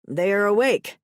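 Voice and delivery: loud, excited call. A narrator's reading. Brother spots the couple outside, calling out loudly. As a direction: call it out to announce them. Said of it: "I hear a voice call out".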